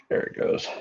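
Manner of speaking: barely breathed, whispered; hushed whisper. Real, not acted. Watching the casting pop out, a hushed whisper. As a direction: whisper it to yourself.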